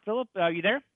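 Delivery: ordinary delivery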